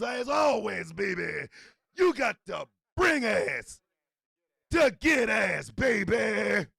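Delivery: loud, high-pitched trash-talking champion; villain wrestling promo. Acted. Villain champion taunts rivals coming for the title, shouted wrestling-promo bravado. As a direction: roar a cocky title challenge, stretching the key words.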